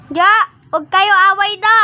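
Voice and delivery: high, loud telling-off; scolding. Real, not acted. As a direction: stop the fight with a sharp call. Scolding fighting pets.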